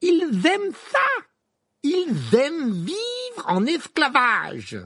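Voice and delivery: very high, loud, slow and drawn out; exasperated Ron. A narrator's reading. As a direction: hammer every word at someone who won't listen. Ron hammering his point. (crié lentement, exaspéré)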